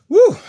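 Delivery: sudden high, loud whoop of excitement; thrilled woo when the reading lands; excited whoop. Real, not acted. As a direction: let out a joyful whoop. Excited whoop at spirit hit.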